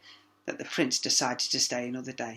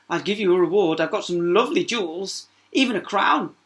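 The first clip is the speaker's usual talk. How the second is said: put-on higher, louder girlish princess; 5 semitones higher, 8 dB louder than usual. A narrator's reading. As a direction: offer treasures as the spoilt princess. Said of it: "said the princess".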